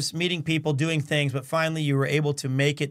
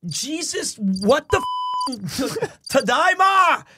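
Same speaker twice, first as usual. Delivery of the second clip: exasperated yell; 9 semitones higher, slower than usual. Retold or imitated. Frustrated husband yelling greeting at his wife, exasperated. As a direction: shout it out, fed up. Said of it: "I was like".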